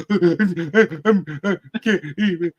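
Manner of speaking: high, slow, laughing mock whimper; imitating a panicking kid. Dad mimicking his terrified son on the swing: a laughing mock whimper. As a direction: play the scared boy who can't go on. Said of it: "He's like".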